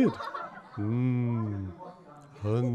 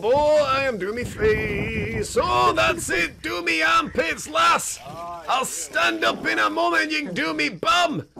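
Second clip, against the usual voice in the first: higher, bossy contented tone; bathtub creature voice; 11 semitones higher than usual. Acted. The animal being bathed orders the lass about in a higher bossy voice. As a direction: order the lass to scrub, pleased.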